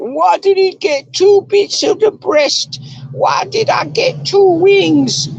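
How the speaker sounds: very high, slow, drawn-out whine; childish complainer. Acted. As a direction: squeal it like a petulant child. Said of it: "It's really childish".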